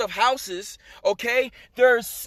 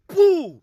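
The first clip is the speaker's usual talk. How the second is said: imitated booming "boo"; loud boom; 6 semitones higher, 5 dB louder than usual. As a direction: punch out a sudden loud bang. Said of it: "like, a real loud sound".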